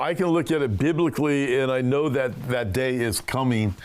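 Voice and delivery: usual speaking voice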